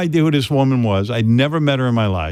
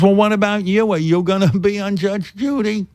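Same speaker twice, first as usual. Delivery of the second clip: mother voice; 7 semitones higher than usual. Retold or imitated. Son voicing his mother.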